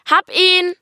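hoch und laut: Hab ihn!; triumphierend gebrüllt. Acted. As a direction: stolz durch die Wohnung brüllen. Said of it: "grüllte ich". (Kind meldet der Mama laut den Fund)

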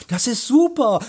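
put-on high-pitched boyish delight. A narrator's reading. Boy welcomes his friend's plan, delighted. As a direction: cheer it high and pleased. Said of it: "freute sich auch Bob".

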